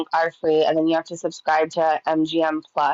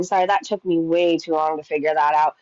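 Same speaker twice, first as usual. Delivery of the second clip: ordinary talk, nothing marked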